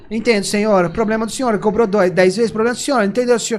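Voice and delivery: mock rote call-centre agent; phone-company operator. Call-centre operator brushing off a customer, mock scripted sympathy. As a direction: parrot apologies like a telephone operator. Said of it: "não é sofre igual a operadora telefônica".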